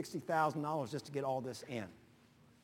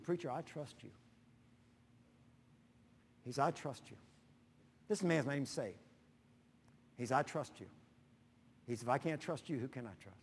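quiet sincere quote; 6 dB quieter than usual. Retold or imitated. Businessman's earnest quiet assurance.

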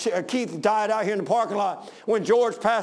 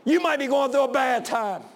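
raised, ringing exhortation; rousing pulpit delivery; full-force preaching; 5 semitones higher than usual. Real, not acted. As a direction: proclaim scripture to the congregation, high and strong.

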